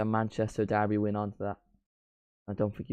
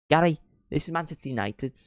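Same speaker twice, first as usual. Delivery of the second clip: put-on light Mancunian accent, higher pitch; 6 semitones higher than usual. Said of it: "I'm doing the [name] accent".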